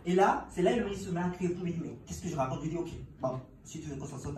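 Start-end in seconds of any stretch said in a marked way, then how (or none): none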